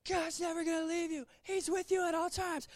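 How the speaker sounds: high, shouting preacher parody; mock young preacher. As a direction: ham up the overeager young preacher. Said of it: "Standing up here saying".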